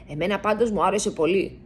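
plain reading voice

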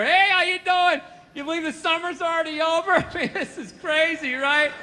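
high, chipper neighborly voice; cheery nerdy dad. Retold or imitated. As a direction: imitate an overly friendly suburban dad. Voicing nice nerdy dad.

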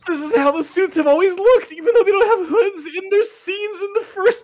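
high-pitched, laughing through the words; laughing disbelief. Real, not acted. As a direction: squeal with amused outrage. Outraged at reshot movie scene.

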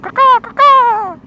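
high falsetto caw-caw; fake bird call. Calling the pigeon out of the hall, high fake caws. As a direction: call out like a bad crow. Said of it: "which isn't the sound that they actually make".

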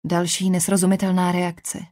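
ordinary delivery, nothing marked

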